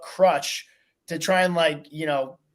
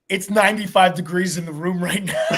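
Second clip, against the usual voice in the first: laughing; 3 semitones higher than usual. Retold or imitated. Retelling roommate's complaint, laughing.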